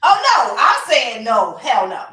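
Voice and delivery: loud, emphatic hell no; a flat-out loud no; loud refusal. Real, not acted. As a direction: shut it down hard and loud.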